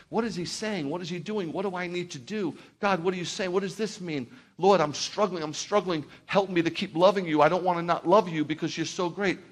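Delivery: pleading prayer voiced high and rising to loud; imagined anguished prayer. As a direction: cry out to God, struggling.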